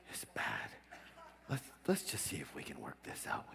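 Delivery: soft confiding whisper. Retold or imitated. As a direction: whisper it like a secret.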